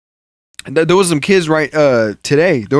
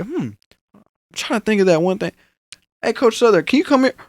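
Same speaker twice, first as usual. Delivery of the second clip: high sing-song Drew voice calling coach; mock Drew; 8 semitones higher than usual. Drew at home calling Coach Southern, high sing-song. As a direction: call the coach sweetly and high. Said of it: "He's like".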